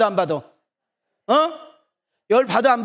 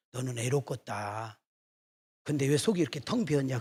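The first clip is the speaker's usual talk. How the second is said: low, soft musing to a statue; hushed talk to the Buddha; 7 semitones lower, 8 dB quieter than usual. Retold or imitated. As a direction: murmur the question quietly, half to yourself. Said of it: "그 부처하고 나하고 이야기하는". (부처에게 낮고 조용히 건네는 말)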